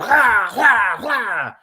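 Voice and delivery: loud, high mock battle yells; imitated wild yells. Commentator mimicking a fresh rival charging in, wild yells. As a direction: yell like a fighter charging in.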